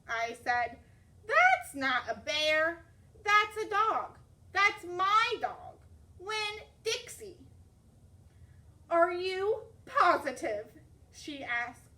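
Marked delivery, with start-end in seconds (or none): 1.3-7.4 higher, louder, slow insisting; insistent. Acted. Girl insists to the librarian that it is her dog. 8.9-10.7 higher, louder, slow; shaky doubt. Acted. Frightened librarian asks the girl if she is sure, uneasy. Scared old lady doubts.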